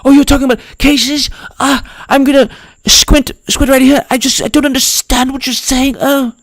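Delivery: hammed-up baffled voice; mock confused interviewee. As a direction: play dumb squinting at the page. Mocking interviewee squinting at paper.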